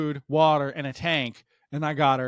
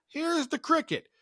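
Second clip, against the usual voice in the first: high mocking comparison; 14 semitones higher, 3 dB quieter than usual. Shown as a demonstration.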